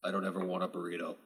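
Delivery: low, very soft, quick murmur; very quiet Orion. Acted. Orion's message over the incoming hail, very quiet. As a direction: say it barely audibly, low and fast. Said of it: "a very quiet Orion voice".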